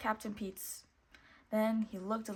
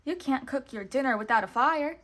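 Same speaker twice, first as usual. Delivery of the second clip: calling out; 3 semitones higher, 7 dB louder than usual. A narrator's reading. Mickey calling out to buyers.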